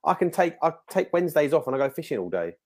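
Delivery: ordinary talk, nothing marked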